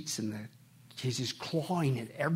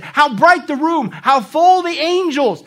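slow, high, loud rapturous wonder; awed wonder; 12 semitones higher, 15 dB louder, slower than usual. Retold or imitated. Dying woman seeing angels, slow high awed wonder. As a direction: marvel at the angels slowly.

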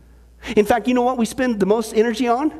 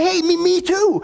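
excited; 8 semitones higher than usual. Retold or imitated. Eager joke character, excited.

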